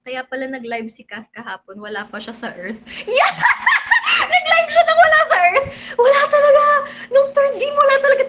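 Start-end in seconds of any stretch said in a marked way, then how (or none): 3.0-8.2 high, loud laughing yell. Real, not acted. Vlogger cracking up about Kath's live stream, laughing shout. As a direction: shriek with laughter.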